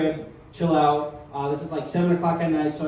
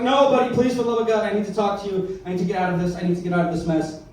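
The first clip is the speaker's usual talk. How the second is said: freaking out; 2 semitones higher than usual. Retold or imitated. Caller begging music-playing friend, hammed-up freaking out. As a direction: beg desperately in mock panic. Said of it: "freaking out".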